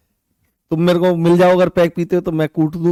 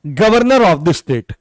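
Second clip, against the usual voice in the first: slow emphasis; much slower than usual. Shown as a demonstration.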